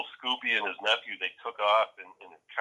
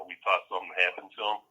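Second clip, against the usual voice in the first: ordinary delivery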